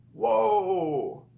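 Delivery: high, drawn-out "whoa" gliding down; amazed whoa. Retold or imitated. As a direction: re-enact being blown away. Acts out her amazed reaction.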